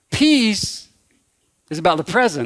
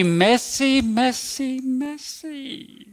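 higher, fading sing-song repetition of messy; playful movie-quote chant of messy, each softer; mock sing-song messy; 7 semitones higher, 5 dB quieter, much slower than usual. Preacher quoting a holiday movie line, higher, fading sing-song. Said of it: "Can you say".

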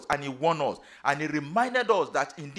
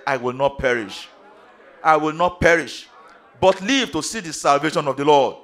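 loud, forceful proclamation; emphatic declaration; 11 dB louder than usual. Shown as a demonstration. Preacher showing congregation what to tell themselves, loud emphatic declaration. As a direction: declare it boldly, building louder. Declaration after "Talk to yourself.

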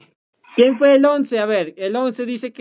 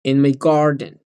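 slow model; 5 semitones lower, slower than usual. Shown as a demonstration.

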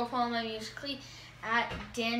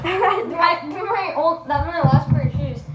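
loud; 6 semitones higher, 12 dB louder than usual. Real, not acted. Loses his last pair.

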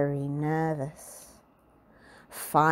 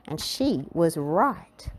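ordinary delivery

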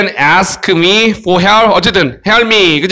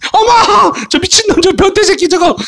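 very high mock-outraged schoolgirl shriek; shocked schoolgirl; 14 semitones higher than usual. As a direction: squeal in horror at a pervert, hammed up. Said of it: "여학생 들어서".

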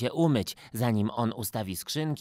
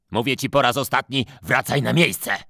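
much louder angry order; shouting; 8 dB louder than usual. Acted. Photographer yells at Gottfried to go back, loud. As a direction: lose patience and yell it. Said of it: "krzyknął fotograf".

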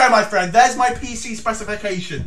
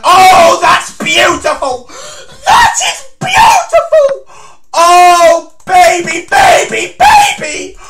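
rising, much higher and louder ecstatic yell; ecstatic shout; 9 semitones higher, 11 dB louder, slower than usual. Real, not acted. Celebrating winning move.

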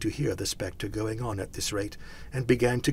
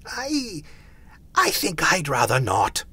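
high, halting start, then louder; nervous reluctance; 6 semitones higher, 5 dB louder than usual. A narrator's reading. Scrooge declining the spirits, nervous. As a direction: stammer out a timid refusal.

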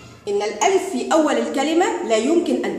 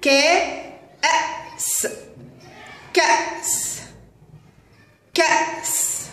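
higher, very slow, voice fading to air; high breathy spelling out; 6 semitones higher, much slower than usual. Shown as a demonstration. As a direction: sound out كأس slowly, high and airy. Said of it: "هيا نتهجأ". (نطق كأس ببطء بصوت عال النبرة وهامس)

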